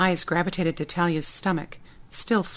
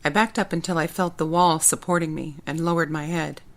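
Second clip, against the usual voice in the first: plain reading voice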